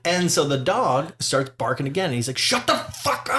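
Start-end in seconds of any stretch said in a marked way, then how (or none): 2.4-3.4 high, fast strained mock yell; rushed, squeezed scolding at the dog; aggressive owner. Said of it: "That's a little aggressive".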